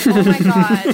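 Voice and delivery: very loud, bursting oh my God; loud exclamation. Shown as a demonstration. Demoing reactions to a funny text, very loud. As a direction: blurt it out at full volume. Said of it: "Or, like".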